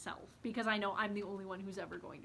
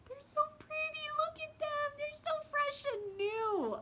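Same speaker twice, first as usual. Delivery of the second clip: much higher, squealing delight; giddy excitement. Real, not acted. Showing viewers her new notebooks, high giddy squeal. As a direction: gush over them in a tiny high squeal. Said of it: "Oh, I get so excited".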